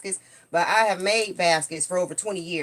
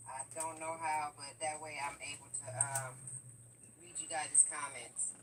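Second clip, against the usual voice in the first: faint delayed echo of her voice; distant playback from a phone speaker; canyon echo; 3 semitones lower, 19 dB quieter than usual. Real, not acted. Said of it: "You can hear the, uh, echo".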